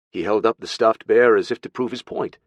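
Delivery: ordinary delivery, nothing marked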